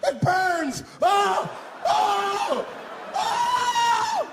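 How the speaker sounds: very high, loud agonized screams; screaming in pain. Retold or imitated. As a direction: wail like it burns. Hit passenger screaming in pain.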